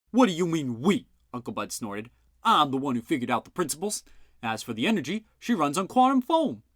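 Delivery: an insulted uncle telling off the sergeant; gruff indignant uncle. A narrator's reading. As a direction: snap back at a dim cop. Bud scoffs.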